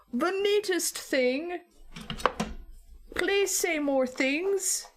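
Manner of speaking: high, very slow, drawn-out wonder; mock awe. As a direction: beg slowly like a fascinated fan. Imitating Americans awed by accents.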